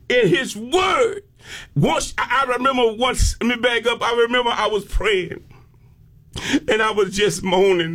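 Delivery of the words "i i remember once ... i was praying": ordinary talk, nothing marked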